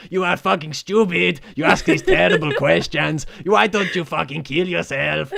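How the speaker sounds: mock Mexican accent, laughing through it; rough Mexican accent impression. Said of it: "a Mexican accent going".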